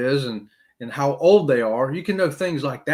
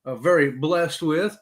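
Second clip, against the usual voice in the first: usual speaking voice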